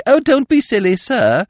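high, loud coy giggle; real housemaid brushing off a flirt; giggling maid. Acted. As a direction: tease him back coyly. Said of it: "she will giggle and remark".

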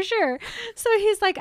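ordinary delivery

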